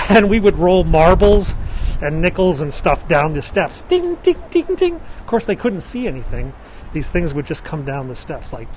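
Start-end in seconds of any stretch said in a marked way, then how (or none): 3.8-5.0 high, louder sing-song ding ding; high bouncing dings. Retold or imitated. Tour guide mimics marbles bouncing down the Halloween steps. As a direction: bounce the sound up like marbles on stone.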